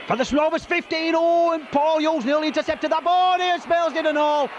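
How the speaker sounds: excited rugby commentary, rising; live play-by-play. Real, not acted. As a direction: call the match live.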